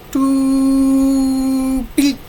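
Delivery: high, robotic beep; high beeping sound effect. Shown as a demonstration. Vlogger reading a comic beep, high pitched. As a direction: chirp it like a machine. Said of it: "So, doo and bleep".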